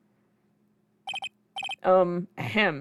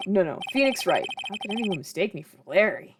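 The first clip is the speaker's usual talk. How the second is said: usual speaking voice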